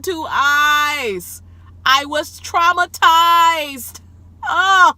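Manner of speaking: high, loud outburst with drawn-out words; scandalized high-pitched holler; shouted disbelief. Retold or imitated. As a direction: yell it in appalled disbelief, stretching the key words. Acting out shock at the video.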